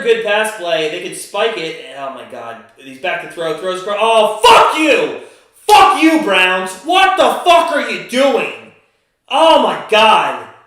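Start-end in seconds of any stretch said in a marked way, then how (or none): 4.0-8.7 real yelled fury, much higher and louder; screaming at the team on a bad play; furious shout. Real, not acted. Fan screaming at the Browns after a bad play, furious shout. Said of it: "I'll try to calm down". 9.3-10.5 exasperated. Real, not acted. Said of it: "I'll try to calm down".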